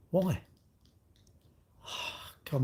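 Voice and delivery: normal talking voice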